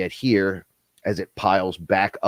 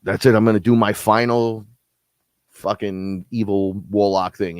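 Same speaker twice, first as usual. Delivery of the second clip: loud, fed up; 6 dB louder than usual. Retold or imitated. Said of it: "He's like".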